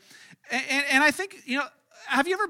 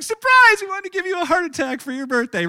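much higher, loud shout of surprise, easing off; mock surprise shout; 8 semitones higher, 7 dB louder than usual. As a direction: scream surprise at the birthday guest.

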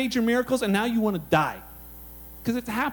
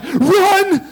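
high, loud shouted command; sudden full-voiced yell; urgent shout; 8 semitones higher, 9 dB louder than usual. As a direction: call out an alarm to run. Acting out Elijah's urgent command.

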